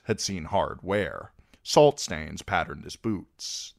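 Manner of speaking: plain reading voice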